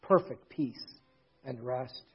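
low, soft, reverent close; hushed solemn preaching. Real, not acted. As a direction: drop to a quiet, reflective murmur, pausing between phrases.

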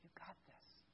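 breathy whispered encouragement. Real, not acted. Pastor whispering courage to the dads. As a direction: whisper it close to them.

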